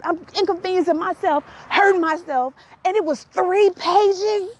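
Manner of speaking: high-pitched, aggrieved comic rant; worked-up retelling, incredulous and high; exasperated tirade. Retold or imitated. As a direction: protest to mom as if deeply wronged. Acted tirade at mom over pages.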